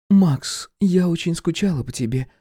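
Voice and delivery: voiced woman Irka. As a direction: lay on the longing. Irka attacks.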